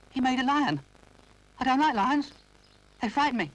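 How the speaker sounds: put-on high, timid little boy voice; Noddy's high childish voice; little toy boy. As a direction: apologise like a small toy.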